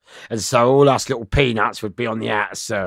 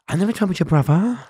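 lightly voiced meek innocent, higher; playing dumb; 8 semitones higher than usual. Denying to the gang member, mild mock meekness. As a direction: play the harmless fool. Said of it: "So I'm playing Sammy".

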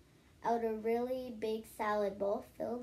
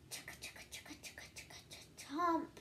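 much softer whispered chugga, then a higher chomp; whispered gobbling noises; 5 semitones higher, 7 dB quieter, much faster than usual. Acted. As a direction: whisper the eating noises, bite at the end. Gobbling pie sound.

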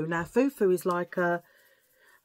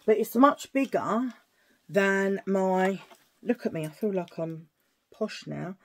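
ordinary delivery

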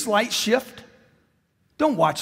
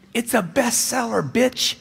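ordinary talk

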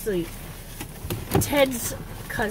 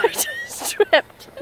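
breathy laugh through the words; laughing at her own stumble; 11 semitones higher, much slower than usual. Real, not acted. Laughs after tripping.

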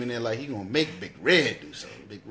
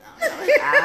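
high fast character voice; 6 dB louder, faster than usual. Retold or imitated. Quoting movie line.

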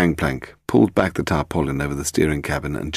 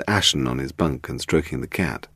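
plain reading voice

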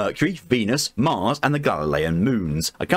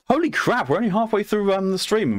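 surprised; 11 semitones higher than usual. Real, not acted. Surprised at stream progress.

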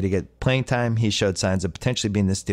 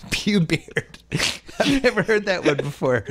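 laughing through the words, pitched up; cracking up; 7 semitones higher than usual. Real, not acted. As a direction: repeat it while breaking into laughter. Cracks up at listener's phrase.

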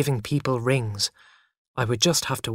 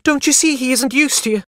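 high, loud mother's plea; crying out; 12 semitones higher, 6 dB louder than usual. A narrator's reading. Mother defending her son to Father, crying out. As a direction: plead urgently at raised pitch. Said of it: "cried Mother".

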